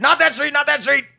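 loud, high comic yelp; high-pitched frantic plea, played for laughs; mock panic. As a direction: cry out in exaggerated alarm. Mock panic driving past bad street.

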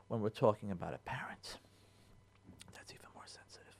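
hushed whisper for a touchy subject. Real, not acted. Rabbi whispering the sensitive part to his listeners. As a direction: lean in and whisper it. Drops to a hush on sensitive topic.

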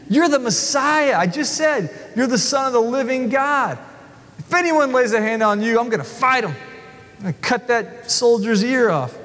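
very high, frantic denial; Peter protesting. Acted. Peter vowing to defend Jesus, high frantic protest. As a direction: panic and bluster. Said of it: "Peter says".

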